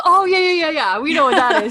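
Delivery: high, loud chorus of agreement; excited, laughing imitation of the men; eager recognition. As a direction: burst out in mock-delighted understanding. Guys finally recognise the word alcohol.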